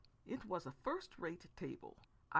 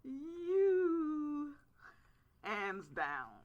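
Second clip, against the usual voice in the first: bright, much higher and louder you; teasing declaration; playful answer; 10 semitones higher, 9 dB louder, much slower than usual. Pretend. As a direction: announce her as the winner. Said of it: "playfully gave him a little poke".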